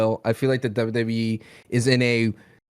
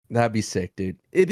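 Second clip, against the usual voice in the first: ordinary talk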